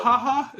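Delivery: high, tentative evil cackle; mad scientist laugh. Pretend. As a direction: try out a villain's mwahaha. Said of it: "Is that".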